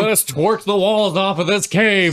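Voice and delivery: loud, high mock-orator; hammed-up rallying call to the crowd; Morpheus. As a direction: parody a rousing speech at full voice. Joins in parodying Morpheus's speech.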